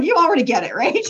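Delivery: loud, high preachy voice; hammed-up preacher declaring; caricature preacher. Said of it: "let's say I talked like that".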